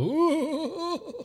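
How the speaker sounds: high wailing ooh; mournful ooh. Pretend. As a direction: wail like a grieving pallbearer. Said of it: "sit in the background and go".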